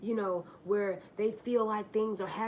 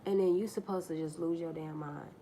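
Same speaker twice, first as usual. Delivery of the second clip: usual speaking voice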